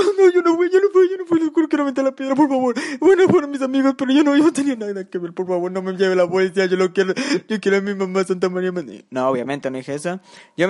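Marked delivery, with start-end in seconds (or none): 0.0-8.7 hammed-up high, loud whine begging for mercy; panicked pleading. Joking that he begged the civil protection officers, mock-panicked whining. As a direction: plead like a mock crybaby, starting high and loud, settling into a whimper. Mock plea to police, never said.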